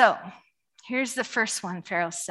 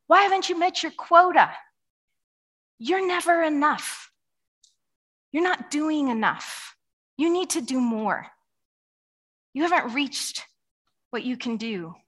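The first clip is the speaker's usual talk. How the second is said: raised, pushy taskmaster voice; demanding taskmaster; 4 semitones higher than usual. Retold or imitated. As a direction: lean in hard like a taskmaster.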